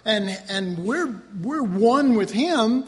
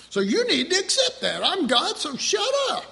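high mocking voice, rising to a squeak; mock God voice; 11 semitones higher than usual. Preacher mocking a petty God, in a high squeaky voice. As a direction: lampoon a petty God, pitched up.

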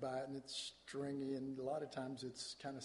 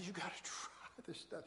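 whispered coaxing; urging whisper; 9 dB quieter than usual. Retold or imitated. The lead singers nudging Randy to try asparagus, in a whisper. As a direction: lean in and whisper the dare. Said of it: "So they're all going".

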